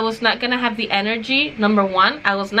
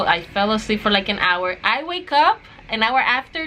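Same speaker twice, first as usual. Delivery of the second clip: normal talking voice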